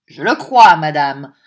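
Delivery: sudden loud, clear, resonant reply; strangely sonorous. A narrator's reading. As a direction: ring the words out, dropping in pitch at the end. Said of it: "une indéfinissable sonorité".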